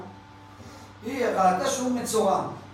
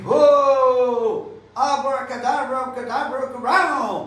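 mock magic spell; 3 semitones higher, 3 dB louder, slower than usual. Imagined prophet's magic incantation.

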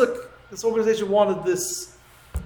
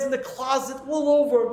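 usual speaking voice

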